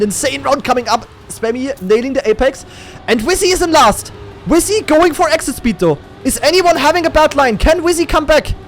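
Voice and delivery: high, building esports race call, peaking then easing; urgent caster at the decisive moment; excited play-by-play. Real, not acted. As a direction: build to a high, stretched cry, then settle.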